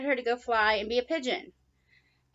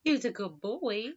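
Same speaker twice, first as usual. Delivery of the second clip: slow tender coo. Real, not acted. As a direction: soften it for your pet. Cooing at bird.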